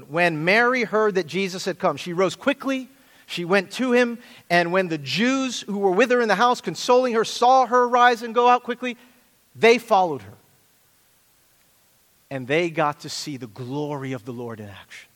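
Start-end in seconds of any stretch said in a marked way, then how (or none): 0.0-10.3 dramatic scripture reading. Acted. Reading scripture with rising force.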